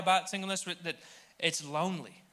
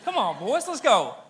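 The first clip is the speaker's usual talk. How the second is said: rallying call; 6 semitones higher, 7 dB louder than usual. Real, not acted. Rallying the single men.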